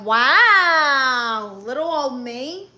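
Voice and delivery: long, loud, swooping "wow"; coy, hammed-up amazement at a compliment; mock wonder. Little girl reacting to teachers praising her legs, hammed-up wonder.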